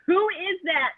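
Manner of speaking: lightly voiced sleep-talker; eerie question. Third time, higher.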